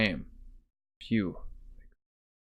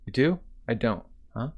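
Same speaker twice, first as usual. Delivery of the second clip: ordinary delivery, nothing marked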